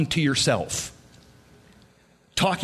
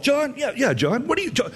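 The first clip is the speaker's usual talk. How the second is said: higher, eager mock self-chatter; talking to himself; 9 semitones higher, faster than usual. Pastor mocking his own inner voice, higher eager chatter. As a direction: egg yourself on, playfully. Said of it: "When I talk to myself".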